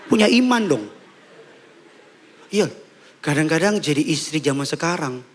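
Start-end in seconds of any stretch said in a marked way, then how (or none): 0.1-0.8 high, exclaimed mock rebuke; acted nagging husband, pitched up; demanding husband. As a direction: scold her for lacking faith. Husband scolding wife over money.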